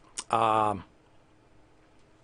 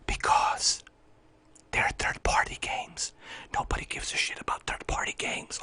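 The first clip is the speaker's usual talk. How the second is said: tense whisper; 10 dB quieter than usual. Acted. Streamer holding back after rant, tense whisper. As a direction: whisper it with forced restraint. Answers own shouted question in whisper.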